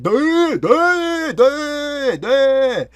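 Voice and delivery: very high, loud, droning duh; mentally ill stranger's duh. Retold or imitated. Imitating the stranger stepping out of the woods, high loud drone. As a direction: mimic the stranger's wordless drone. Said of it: "all he would say".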